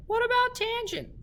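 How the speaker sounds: put-on very high, squeaky kid voice; squeaky student voice. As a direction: pipe up like a curious kid. Teacher voices student question.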